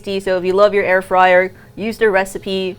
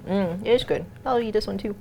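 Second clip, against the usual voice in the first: talking while crunching; crunching chips; 9 dB quieter than usual. Real, not acted. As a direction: mouth full, chewing. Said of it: "you can hear me crunching away".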